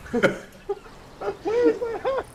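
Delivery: very high, very slow wail of where is my hat; dragged-out high squealing cry; desperate wailing shout. Acted. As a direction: wail it like the hat is gone forever. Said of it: "and he's like".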